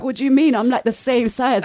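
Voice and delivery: high, loud, incredulous protest; indignant disbelief. Retold or imitated. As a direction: act out being baffled at the claim. Retelling her shocked reply.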